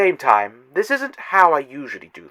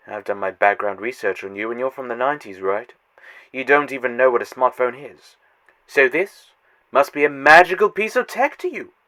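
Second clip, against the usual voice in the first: ordinary delivery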